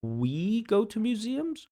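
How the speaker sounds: a puzzled teacher pulling up a student's odd phrase; incredulous echo. Real, not acted. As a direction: echo it back as if you can't believe it. Teacher echoes candidate's odd phrasing.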